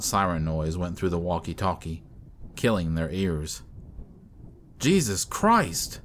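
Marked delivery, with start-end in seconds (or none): 4.8-6.0 high, drawn-out cry of shock; startled exclamation. A narrator's reading. Jerry reacting to a deafening siren, startled outcry. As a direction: exclaim it slowly, pitch jumping high.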